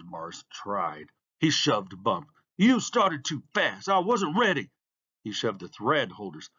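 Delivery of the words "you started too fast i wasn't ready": high, raised accusing voice; indignant protest. A narrator's reading. Beaten racer blaming the starter, indignant. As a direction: complain hard like a sore loser.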